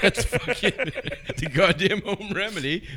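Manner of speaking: high, laughing mock scolding; laughing rant. Real, not acted. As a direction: scold him while cracking up. Ranting at friend, laughing.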